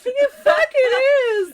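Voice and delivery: high, loud, drawn-out wail; outraged comeback. Retold or imitated. Wife insisting world revolves around her, loud outraged wail. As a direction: yell it in mock tantrum. Retold quarrel, yelled comeback.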